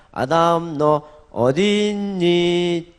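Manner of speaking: high, slow call of 아담, 너 어디 있니; drawn-out tender calling; gentle calling. Pretend. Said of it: "질문했잖아요".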